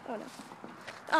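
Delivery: breathy whispered dismay; a quick worried whisper under the breath. Real, not acted. Filming in the cemetery, she whispers her dismay.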